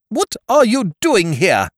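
high, loud curt demand. Acted. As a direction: demand it curtly, no patience. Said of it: "demanded Miss Ukridge curtly".

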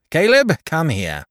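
calling Caleb. Acted. Tackleton summons Caleb.

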